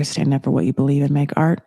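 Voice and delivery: normal talking voice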